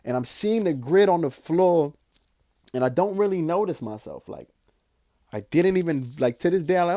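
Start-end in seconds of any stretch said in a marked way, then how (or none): none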